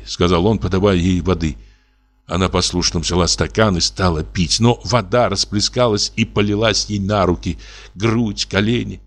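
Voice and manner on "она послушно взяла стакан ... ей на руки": plain reading voice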